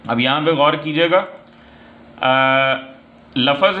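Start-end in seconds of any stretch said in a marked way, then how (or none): none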